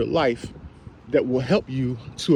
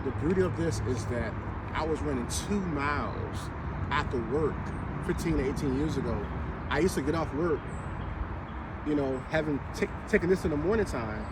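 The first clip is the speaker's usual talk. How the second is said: normal talking voice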